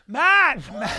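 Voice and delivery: high, loud shouted name; photographers calling out. Retold or imitated. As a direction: holler for the star's attention. Retold photographers calling Matt LeBlanc.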